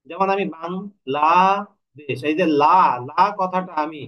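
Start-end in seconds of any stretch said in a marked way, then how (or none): 0.6-2.2 "Bangladesh" slowly, the লা held long; drawn-out open syllable. Shown as a demonstration. Teacher showing an open syllable can be held, drawn-out লা in "Bangladesh". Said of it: "যতক্ষণ খুশি ততক্ষণ ধরে স্বরতন্ত্রীতে টানতে পারবো". 2.6-3.2 "লা" drawn out on its vowel; held syllable. Shown as a demonstration. Teacher showing which syllable can be stretched, held "লা". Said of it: "এইভাবে আমি টেনে যেতে পারবো".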